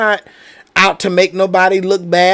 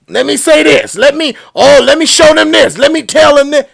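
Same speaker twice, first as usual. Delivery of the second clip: higher-pitched, eager retort, piling up; clapping back; 4 semitones higher than usual. Acted. Host voicing his urge to answer his critics, clapping back. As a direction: act out a mounting urge to hit back. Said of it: "the natural reaction is to clap back".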